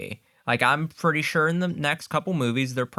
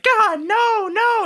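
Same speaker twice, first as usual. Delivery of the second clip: very high, loud mimicked scream; mock scream; 6 dB louder, much slower than usual. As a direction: act out her horrified cries, high and loud. Retelling the woman's screams in scene.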